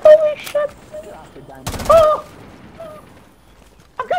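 loud very high squeak; squeaker voice. Shown as a demonstration. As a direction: shriek it like a kid. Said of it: "now I can use my normal voice".

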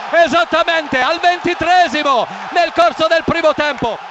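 excited goal announcement. Real, not acted.

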